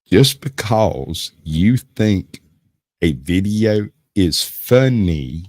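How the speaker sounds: put-on lightly drawn-out Tennessee accent; slow Southern accent. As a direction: stretch every word, Tennessee style. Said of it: "My Southern accent's a little quick for some folks".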